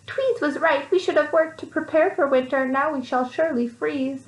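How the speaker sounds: high, fretful little mice; a hint of cold fear; worried mice. A narrator's reading. Scared mice voice.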